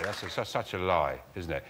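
ordinary talk

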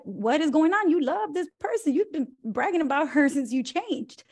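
mock astonished friend; surprised concern. Reacting to upset friend.

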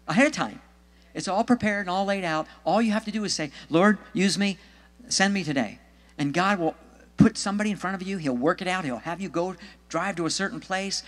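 high, emphatic exhortation; fervent preaching. Acted. Preacher urging soul winners to be willing, fervent.

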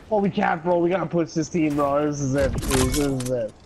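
ordinary talk, nothing marked

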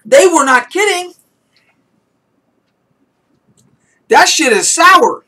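high, loud yelps at sour taste; eating sour candy. Real, not acted. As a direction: shout through the sour shock.

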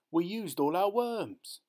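put-on high, slow child's lament; dismayed boy. A narrator's reading. Boy telling Dad the bait is gone, dismayed. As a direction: draw it out high like a let-down kid.

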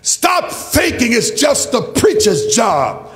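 normal talking voice

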